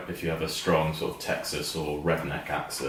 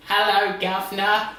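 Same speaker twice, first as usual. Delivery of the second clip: high, loud mock-Cockney call; Cockney impression; 5 dB louder than usual. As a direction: do a hammed-up Dickensian Cockney. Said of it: "kind of Cockney".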